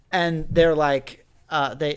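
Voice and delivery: usual speaking voice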